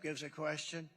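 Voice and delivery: ordinary delivery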